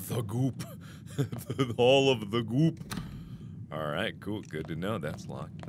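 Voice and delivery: ordinary talk, nothing marked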